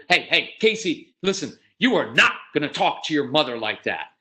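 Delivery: acted, forceful reprimand; stern scolding. Father to son yelling at his mother, stern. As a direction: lay down the law, emphatically.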